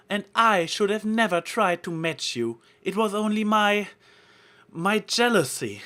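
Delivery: high, loud, dramatic grovelling; theatrical apology. A narrator's reading. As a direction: throw yourself into a stagey plea. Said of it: "Trixie exclaimed".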